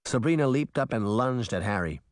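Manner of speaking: usual speaking voice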